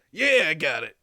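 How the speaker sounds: loud, put-on character voice; big, loud acknowledgement in character; changed character voice. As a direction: belt it out in a new character voice. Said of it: "your voice really changed".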